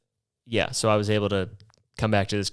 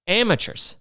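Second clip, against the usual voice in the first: scornful; 9 semitones higher than usual. Acted. Comic scorn repeated.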